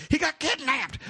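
high, panicked mock wail; Joey. Keeper reporting a friend snatched, hysterical mock cry. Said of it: "and Joey's like".

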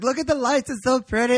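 high-pitched gushing imitation; hammed-up awe at pretty lights; mock childlike wonder. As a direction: imitate his sudden delight, voice way up. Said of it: "and now he's like".

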